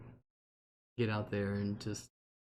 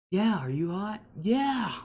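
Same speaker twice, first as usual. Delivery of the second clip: put-on playful little girl voice; 9 semitones higher, 4 dB louder than usual. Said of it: "And then the next day".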